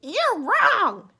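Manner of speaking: high, loud snap; Missus Bird scolding. Acted. Missus Bird correcting her husband, high and loud. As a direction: tell him off sharply.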